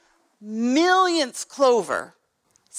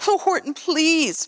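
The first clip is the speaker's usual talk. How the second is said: higher, lightly voiced pleading mayor; 6 semitones higher than usual. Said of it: "pled the voice of the mayor".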